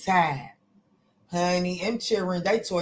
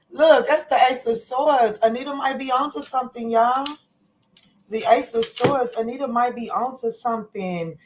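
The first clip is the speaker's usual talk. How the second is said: excited; 5 semitones higher than usual. Real, not acted. Reader thrilled the card backs Anita, higher and excited. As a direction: say it high with growing excitement.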